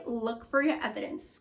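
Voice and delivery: usual speaking voice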